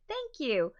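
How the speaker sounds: ordinary talk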